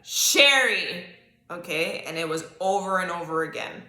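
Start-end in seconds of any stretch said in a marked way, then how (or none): 0.0-1.0 higher, loud yell of a name; loud call. Retold or imitated. Imitating the voice that woke her, loud yell. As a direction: call it out hard, as if to wake someone. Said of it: "It was loud like that".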